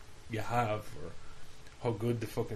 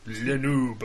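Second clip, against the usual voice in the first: mock French accent; 6 dB louder, much slower than usual. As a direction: fake French "Le noob". Mocking French gamer.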